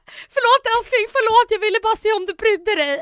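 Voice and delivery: high, loud sing-song begging sorry; overdone sweet apology to her boyfriend; pleading girlfriend. Retold or imitated. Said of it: "Och hon är så här". (hög, gäll och överdriven ursäktande flickvän)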